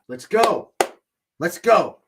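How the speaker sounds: loud, eager cheer; root for your team out loud; excited cheer. Real, not acted. Cheering on a draft pick.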